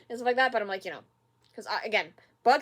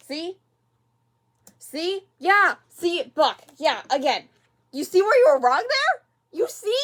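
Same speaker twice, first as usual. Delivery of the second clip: higher and louder, told-you-so; lecturing Buck in a raised, high voice; vindicated scolding; 6 semitones higher, 6 dB louder than usual. Real, not acted. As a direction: point at the screen and gloat that he was wrong.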